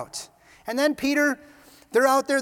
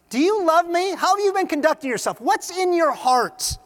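loud, high, insistent questions; pressing questioning; 9 semitones higher, 7 dB louder than usual. Acted. Jesus questions Peter about his heart, insistently. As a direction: press harder, stretching the last question.

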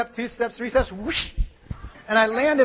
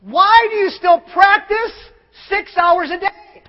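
raised, louder, slow amazed question; admiring questioner; 8 semitones higher, 9 dB louder, slower than usual. Retold or imitated. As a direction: marvel at the master as you ask. Questioner praising the famous cellist.

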